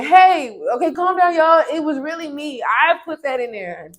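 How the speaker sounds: high, loud pleading to calm everyone; calming peacemaker. Pretend. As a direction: act out the apology he should have made. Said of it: "could have literally ran out there and said".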